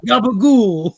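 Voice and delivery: put-on New York Italian accent. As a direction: belt it like a Jersey wiseguy.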